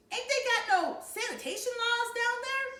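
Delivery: high, loud incredulous ranting; outraged disbelief. Acted. Byron ranting to parents about outhouses, shrill with disbelief.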